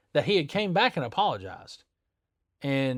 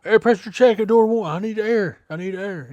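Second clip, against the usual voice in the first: loud, pushy, higher-pitched customer voice; mock demanding customer; 7 semitones higher, 6 dB louder than usual. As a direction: barge in demanding air. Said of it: "You know".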